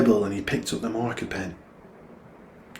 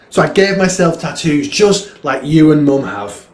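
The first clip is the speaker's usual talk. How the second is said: raised, loud voice pouring out frustration; a boy venting, higher and louder; frustrated outburst; 7 semitones higher, 12 dB louder than usual. Acted. A son tells his dad he hates his legs, frustrated outburst.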